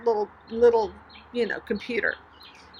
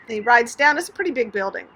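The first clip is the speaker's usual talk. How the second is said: ordinary talk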